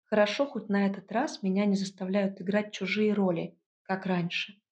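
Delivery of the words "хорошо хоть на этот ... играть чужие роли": plain reading voice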